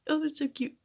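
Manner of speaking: high-pitched squeal easing into a coo; silly cooing. Retold or imitated. Reacting to silly parts of the show, high silly cooing. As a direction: gush in a high, cutesy voice. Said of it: "during the super silly parts".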